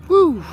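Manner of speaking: hot exhale. Real, not acted. Sweating in the heat.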